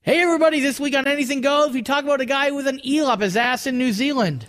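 loud, high announcer read; radio promo voice. Acted.

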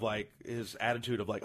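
normal talking voice

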